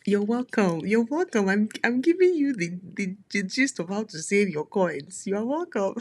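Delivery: high, loud teasing you're welcome; sing-song, playful, proud of the tip; playful brag. Real, not acted. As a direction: gloat over the deal like a gift. Playful brag after deal tip.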